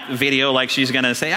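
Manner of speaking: normal talking voice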